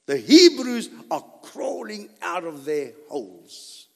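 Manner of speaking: usual speaking voice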